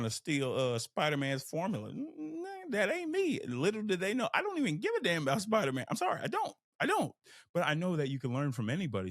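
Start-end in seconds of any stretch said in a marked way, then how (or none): 1.9-7.1 squeaky high-pitched denial; mock high protest. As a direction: pitch way up, playfully swearing it isn't you. High-pitched mock protest about Spider-Man.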